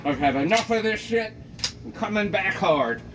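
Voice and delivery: fed-up neighbor. Acted. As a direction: snap it out, fed up. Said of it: "She's like".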